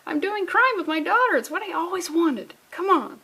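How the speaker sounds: high-pitched mock gushing mother; super excited mom. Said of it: "the mom's super excited".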